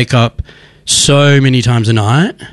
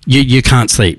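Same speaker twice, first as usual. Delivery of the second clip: usual speaking voice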